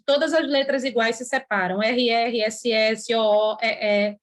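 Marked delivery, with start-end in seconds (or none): none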